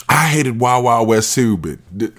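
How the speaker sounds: usual speaking voice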